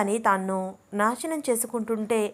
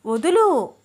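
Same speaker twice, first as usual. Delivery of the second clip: వదులు snapped out louder and higher, falling hard; louder demand; 7 semitones higher, 7 dB louder, slower than usual. A narrator's reading. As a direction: insist he let go, now raising the voice. (గట్టిగా వదులు అని అరవడం)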